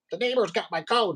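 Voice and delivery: mock the customer's complaint; mimicking the lady. Retold customer blaming the neighbor, high and whiny. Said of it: "she's all".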